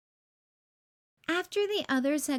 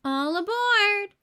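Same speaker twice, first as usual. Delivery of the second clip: a loud, slow, higher conductor's call; 8 semitones higher, 6 dB louder, slower than usual. A narrator's reading. Barney calls everyone onto the toy train, loud call. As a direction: sing out to the whole train. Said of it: "called Barney".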